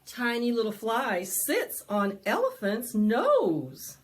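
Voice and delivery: lively, sing-song picture-book reading; animated storytime voice; reading to children. A narrator's reading. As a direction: read it big and bouncy for little ones.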